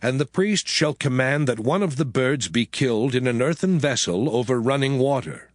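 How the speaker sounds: usual speaking voice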